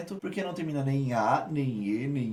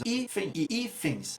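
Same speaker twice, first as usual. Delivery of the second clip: "Hífen" and "hífens" slow, higher on each "hí"; stressed first syllables; 5 semitones higher, 4 dB quieter, slower than usual. Shown as a demonstration. Teacher comparing two words for students, slow "Hífen", "hífens" stressed on "hí". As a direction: stretch each "hí", letting the endings fall softer. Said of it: "Qual é a sílaba tônica?".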